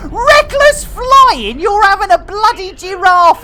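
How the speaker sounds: hammed-up disbelief; mock outrage. Real, not acted. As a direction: play it hurt and scandalised. Outraged at another player in game.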